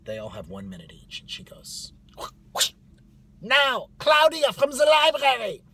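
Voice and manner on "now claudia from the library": very high, loud summons; caricatured shout calling up the next speaker; strict orientation leader. Retold or imitated. Woman running orientation calls up Claudia, barking high.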